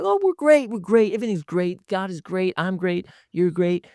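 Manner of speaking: high-pitched mock gush that eases as the list runs on; over-cheerful insistence. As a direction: rattle off reassurances, starting very high. Mimics someone insisting all is fine.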